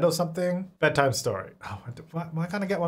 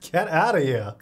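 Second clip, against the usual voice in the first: ordinary talk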